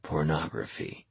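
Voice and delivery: hushed, slow and secretive; whispered. A narrator's reading. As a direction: drop to a very soft, drawn-out murmur. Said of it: "and Bishop whispered".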